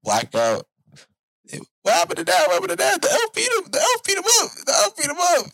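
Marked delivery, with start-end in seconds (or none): 1.5-5.5 put-on much higher, frantic kid voices; panicked kids. Kids find dad knocked out, frantic and much higher. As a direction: play a scared child shouting about the elf.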